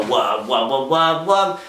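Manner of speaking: wub noises rising in pitch; mock dubstep bass wobble; imitating dubstep. Vlogger mocking music you can't sing along to, wubbing. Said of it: "I can't go".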